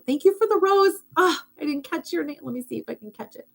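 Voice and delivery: sudden squeaky-high thanks to a viewer; bright thanks. Real, not acted. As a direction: gush over the gift, then look for the name. Livestream viewer sent a rose.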